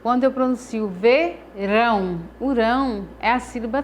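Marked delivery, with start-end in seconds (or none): 0.9-2.2 "verão" said slowly with force on the final "rão"; teacher's slow "verão", stressing "rão". Shown as a demonstration. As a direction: lean on the last syllable of "verão". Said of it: "é a sílaba que eu pronuncio com mais força".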